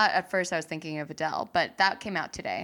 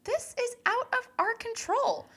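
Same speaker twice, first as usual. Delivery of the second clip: imitating [name]; 5 semitones higher than usual. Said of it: "And I'm like".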